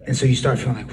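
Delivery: ordinary talk, nothing marked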